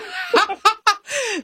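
long high giggle; high laugh. Real, not acted. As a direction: giggle warmly. Laughing at caller.

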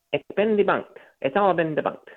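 lightly mocking a smug little journalist; imitating the reporter's nasal insistence; nasally Politico man. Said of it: "with his little nasally voice".

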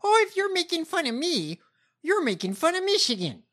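high-pitched mocking politician voice; Haley Stevens impression. Co-host mocking candidate's complaint about Michigan, high mock voice. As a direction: mimic the candidate in a high put-on voice. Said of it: "Like, she's like".

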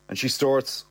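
plain reading voice

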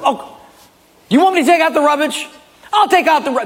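high, fast mock-sweet sarcasm; sarcastic wife. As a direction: snap back sweetly but sarcastic. Wife snaps back sarcastically.

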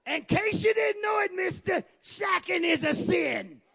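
high, shouted sermon rebuke; full-force preaching. Real, not acted. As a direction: preach it hard, high and ringing.

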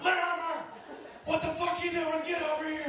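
hammed-up furious coach bark; angry coach. Coach yelling at Larimer during the timeout, hammed-up angry bark. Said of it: "He's like".

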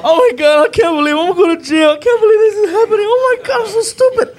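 hammed-up, very high tearful wail; freaking out. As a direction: wail in mock panic on the verge of tears. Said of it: "freaking out".

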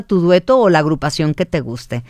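usual speaking voice

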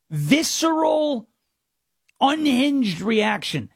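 slow, stressing each word; leaning on the words for effect; drawn-out emphasis. Real, not acted. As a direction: spell out visceral and unhinged slowly.

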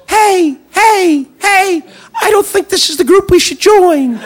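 high, squeaky little voice of reason; a tiny voice pleading in your head; little inner voice. Retold or imitated. Comic's survival instinct squeaking a warning, high and tiny. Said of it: "a little voice should go off".

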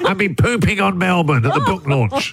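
hammed-up sick-day voice; fake sick call to the boss. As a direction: whine it like skiving off work. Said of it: "This is the voice you do when you call your boss".

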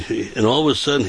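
usual speaking voice

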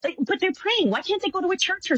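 sarcastic high echo; mocking mimicry. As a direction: parrot her back with disbelief. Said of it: "And I'm like".

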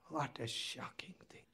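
near-whispered disapproval; hushed aside. Real, not acted. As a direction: drop to a whisper, as if scandalised. Hushed aside on patrons' money.

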